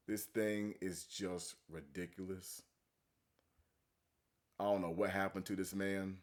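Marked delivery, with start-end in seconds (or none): none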